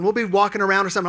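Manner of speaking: ordinary delivery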